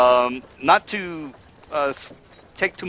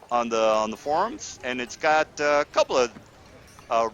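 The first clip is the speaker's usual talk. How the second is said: usual speaking voice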